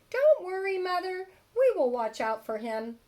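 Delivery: high, loud little wolf voices; little wolves. Acted. Wolf cubs reassure their mother, high and loud. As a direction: squeak it out like eager little cubs. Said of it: "said the three little wolves".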